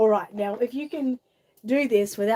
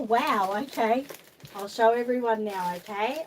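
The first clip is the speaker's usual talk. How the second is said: ordinary delivery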